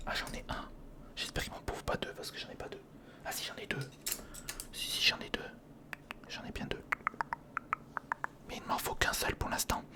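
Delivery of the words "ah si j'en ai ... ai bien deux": normal talking voice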